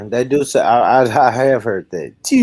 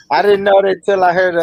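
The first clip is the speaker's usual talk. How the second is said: usual speaking voice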